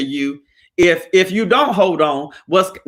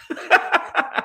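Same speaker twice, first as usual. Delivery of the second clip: breathy laugh; 11 semitones higher than usual. Real, not acted. Laughing at Bobby.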